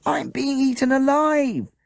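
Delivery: put-on high, loud, slow wail of a child; panicked child cry. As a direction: overdramatic child shrieking under the dogs. Children knocked down by hounds.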